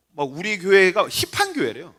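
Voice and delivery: ordinary talk, nothing marked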